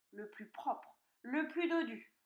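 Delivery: usual speaking voice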